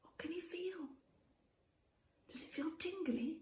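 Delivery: put-on soft whispered prompts to a child; whispering. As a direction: whisper the questions gently.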